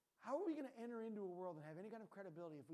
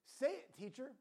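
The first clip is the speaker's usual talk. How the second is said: a sudden high, loud "Say it, teacher"; mock-bold retort; loud challenge; 8 semitones higher, 10 dB louder, slower than usual. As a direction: fire the reply back, high and loud. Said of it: "And he answered".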